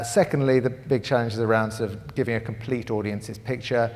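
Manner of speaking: normal talking voice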